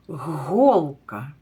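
"голка" said clearly and firmly; clear demo word. Shown as a demonstration. Teacher to pupils finding the first sound, "голка" said clearly. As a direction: pronounce "голка" carefully for children. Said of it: "Слухайте".

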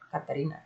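Katarina, four clear syllables, ka-ta-REE-na; name demonstrated. Shown as a demonstration. Streamer repeating the right pronunciation for viewers, ka-ta-REE-na. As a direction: Katarina said quietly, placed like a lesson. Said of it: "That's how you say it".

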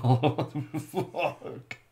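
slow, laughing oh what the fuck; laughing disbelief. Retold or imitated. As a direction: dissolve into laughs mid-line. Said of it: "Pedro Pascal's like".

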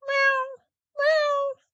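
very high thin mew; a tiny kitten mewing; kitten meow. Acted. Kitten mewing from the girl's pocket, very high.

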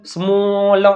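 સ્મોલ with a stretched 'o'; sounded out. Shown as a demonstration. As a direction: blend સ્મોલ slowly, ending on L. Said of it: "સ એટલે એસ અને મો ભેગા છે". (સ્મોલ લંબાવીને)

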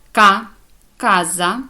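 «ка» loud and alone, then «каза» with the same k; hard k. Shown as a demonstration. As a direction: model «ка», pause, then «каза». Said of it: "читається як".

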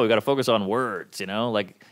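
ordinary talk

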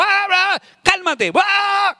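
high shouted bra bra cálmate; mock shout. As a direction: mimic someone yelling calm down while yelling. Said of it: "si usted machea el nivel de voz".

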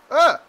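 short, loud, high "ah"; sudden yelp of alarm; startled yelp. Real, not acted. Startled in game.

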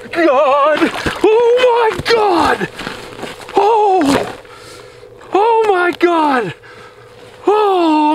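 real disbelief spilling out over a huge catch; squealing, stunned joy; freaking out. Real, not acted. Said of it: "I'm freaking out".

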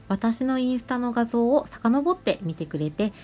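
ordinary talk

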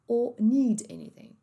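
slow British or, without its R; British accent. Shown as a demonstration. Teacher repeating the phrase, slow British accent. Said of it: "uma família mais britânica".

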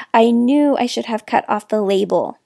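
ordinary delivery

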